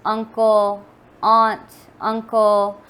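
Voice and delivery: loud, emphatic spoken-word delivery; poem recitation. Acted.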